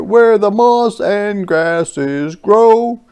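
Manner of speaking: high, loud, slow deer voice; a gentle searching deer. Acted. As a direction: deer looking for shelter. Deer speaks in story.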